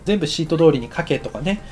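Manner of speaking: normal talking voice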